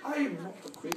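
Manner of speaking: a lightly voiced stern boss, shutting them down; curt boss retort.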